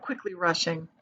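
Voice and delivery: usual speaking voice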